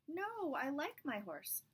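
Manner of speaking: a fond potter clinging to his horse; potter's high plea. Acted. Potter refusing the prime minister, high and slow.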